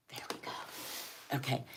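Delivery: soft whispered aside. Real, not acted. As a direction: breathe it under your breath. Quiet aside while moving box.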